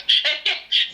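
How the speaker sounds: high airy giggle; light breathy chuckle; amused laugh. Real, not acted. Laughs at the choking scene.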